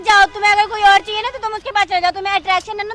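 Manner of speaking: loud, high, hurt shouting at him; angry shouting. Real, not acted. Girlfriend shouting at boyfriend to leave her, furious. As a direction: throw him out of her life in fury.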